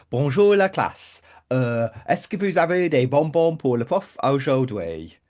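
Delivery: put-on French spoken with a lightly done Brummie accent; teacher greets the class in Brummie-accented French. Imitating French teacher Missus Bolcom in a Brummie accent. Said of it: "spoke French with a Brummie accent".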